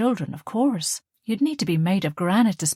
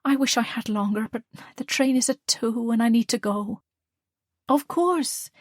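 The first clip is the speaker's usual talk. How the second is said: a touch higher character voice; lightly voiced dialogue; 5 semitones higher than usual. Dialogue between daughter and mother, lightly voiced. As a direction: read the lines in voice.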